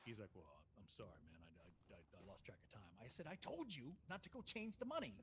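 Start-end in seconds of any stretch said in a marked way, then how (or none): 0.3-2.9 low, soft mumbled excuse; friend's quiet, stammered sorry; imitating sheepish apology. 3.3-5.1 mild mock scolding, pitched higher; higher-pitched retold reproach; retold anger. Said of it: "I was so mad at him".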